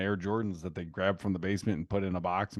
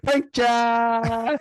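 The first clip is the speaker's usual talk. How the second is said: put-on loud, high kid shout; shrill mock-triumphant cry; imitating gleeful child; 12 semitones higher, 8 dB louder than usual. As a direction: yell it like a child springing a prank. Imitates child springing the prank.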